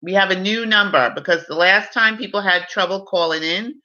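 usual speaking voice

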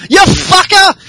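high furious shout; angry yell. Retold or imitated. Becky charging out at Charlotte, yelling. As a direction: scream it at her as you charge in. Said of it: "Becky Lynch comes out and goes".

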